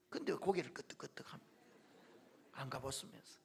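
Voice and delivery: a whispered teasing aside, fast. Real, not acted. Pastor teasing an elder who nods along: whispered jab. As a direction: lean in and tease them under your breath.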